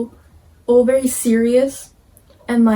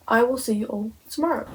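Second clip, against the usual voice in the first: ordinary talk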